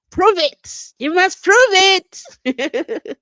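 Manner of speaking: high, loud playful challenge, last words stretched out; mock demanding shout. Host playfully demands proof and then laughs, mock shouting. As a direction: shout it as a hammed-up demand, dragging the ending.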